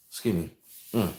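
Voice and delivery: ordinary delivery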